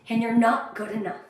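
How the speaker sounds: ordinary delivery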